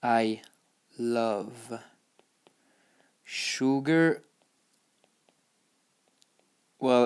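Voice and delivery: one word at a time, long pauses between; word-by-word dictation. Shown as a demonstration. Teacher translating I love sugar for learners, word by word. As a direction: spell it out slowly for beginners, isolating each word.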